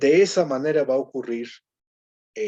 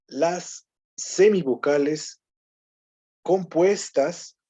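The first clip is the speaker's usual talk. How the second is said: slow dictation; 4 semitones higher, much slower than usual. Shown as a demonstration. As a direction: spell out "Las semivocales compuestas" slowly, word by word, for note-taking. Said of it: "Anoten, vayan anotando todo esto". (dictado lento y claro)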